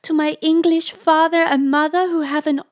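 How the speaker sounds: high, loud, rushing-on orders; excited instructions. A narrator's reading. Lisa quoting his orders to leave, high and excited. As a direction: send her off with loud high urgency.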